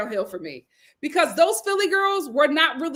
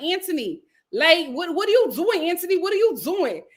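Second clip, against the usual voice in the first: higher-pitched imitation of a put-on Long Island accent; mock Long Island accent; 4 semitones higher than usual. Host mimics a girl scolding her date, fake Long Island accent. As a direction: whine it in exaggerated Long Island vowels. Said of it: "talking like she was from Long Island".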